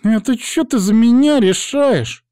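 very high, loud indignant woman; high-pitched slattern taking offence; bristling. A narrator's reading. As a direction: squawk in slow, drawn-out outrage. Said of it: "вдруг взъерепенилась прелестная девица".

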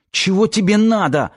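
high-pitched alarmed protest; resisting. A narrator's reading. Girl resisting an attacker demands what he wants, alarmed. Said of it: "сопротивлялась та".

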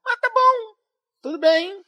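high, loud huffy sulk; mock resigned. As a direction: play wounded but amused.

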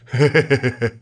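low, sheepish he-he-he, put on; repeated low mock chuckling; imitated drunken laugh. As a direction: mimic a tipsy husband's silly chuckle. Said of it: "cười hề hề như vậy đó".

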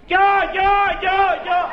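wife rushing from the bedroom, shrill high yelps; squealing wife. Retold or imitated. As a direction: shriek like a wife racing to the phone. Wife running to phone.

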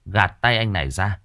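normal talking voice

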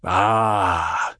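low, slow villain voice; deep menacing nobleman; Baron voice. A narrator's reading.

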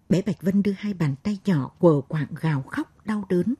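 ordinary delivery, nothing marked

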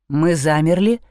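usual speaking voice

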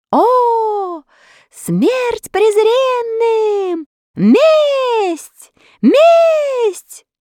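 very high, loud roar of rage; furious shout. Acted. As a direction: bellow for vengeance. Enraged crowd roars for revenge.

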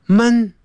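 闷 said as an open "mun" with an "ah" vowel; 闷 with the vowel of 肚子饿的饿; men sound. Shown as a demonstration. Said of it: "啊的音".

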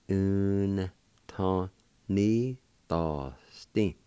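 very slow, stretched-out syllables; syllable-by-syllable demo. Shown as a demonstration. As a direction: draw out every syllable with gaps between. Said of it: "like this".

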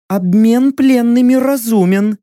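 high, firm warrior woman's voice; a proud captive bargaining; proud female warrior. Acted.

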